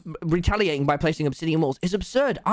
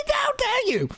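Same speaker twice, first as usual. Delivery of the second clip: mock outrage; slower than usual. [name] outraged at being shushed.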